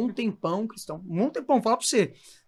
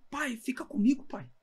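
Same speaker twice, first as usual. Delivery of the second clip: retold plea, high and pleading, softer; pleading with his father; 6 semitones higher, 4 dB quieter than usual. Son begging his dying father to stay, pleading. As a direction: beg him gently to hold on. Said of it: "eu falava".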